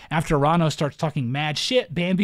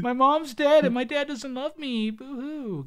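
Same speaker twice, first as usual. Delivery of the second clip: fake crying; 9 semitones higher than usual. Mocking Bambi's self-pity.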